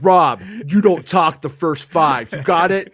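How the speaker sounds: bossy, put-on tough voice; mock bossy voice. [name] imitates himself bossing Rob.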